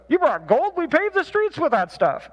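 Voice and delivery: imitating dad telling Saint Peter's line wrong; dad's version. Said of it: "he always screws up the punchline".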